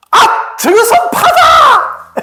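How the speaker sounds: high, drawn-out cry of discovery; loud, high acted yell, last word held long; retold shout. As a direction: shout it out as a sudden revelation. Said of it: "소리를 지르는 거야".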